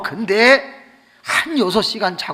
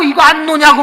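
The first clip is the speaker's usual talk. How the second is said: higher, louder angry demand; 12 semitones higher, 13 dB louder than usual. Retold or imitated. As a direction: snap at her to let him go. Frozen husband demands release.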